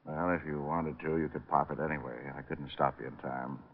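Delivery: low, soft, tense; low and soft, aware of the danger; quiet tension. Acted. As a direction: speak low with menace under it. Detective tense, neck in killer's hands.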